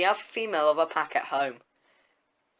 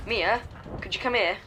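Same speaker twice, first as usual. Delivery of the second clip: a half-hearted story shout, pitched up; mild mock shout; 5 semitones higher than usual. A narrator's reading. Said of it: "I shout".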